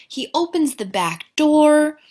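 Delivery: usual speaking voice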